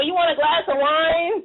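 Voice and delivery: hammed-up smooth talker; mock seductive offer. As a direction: tempt her with the wine, playfully. Voicing the ex offering wine.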